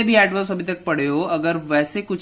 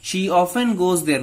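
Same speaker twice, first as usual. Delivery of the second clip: slow example read; 2 semitones higher, much slower than usual. Shown as a demonstration. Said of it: "teacher reads example sentence".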